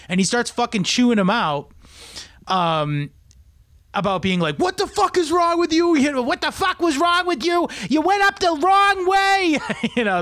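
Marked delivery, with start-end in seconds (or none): 4.6-9.6 angry Boston cop. Retold or imitated. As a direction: bark it out like a furious Boston cop with a Boston accent. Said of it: "that's a Boston cop sounds like that".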